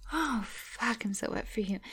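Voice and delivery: usual speaking voice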